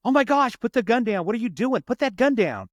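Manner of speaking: high, urgent cop command; acted panic, pitch jumping way up; retold alarmed plea. Cop to girl with gun at end of hall, alarmed.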